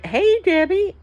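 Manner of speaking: high, loud friendly hello; cheerful greeting. Retold or imitated. Wife greeting her cheerfully.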